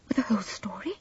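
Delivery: frightened whisper. A narrator's reading. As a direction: hush it in dread. Frightened question.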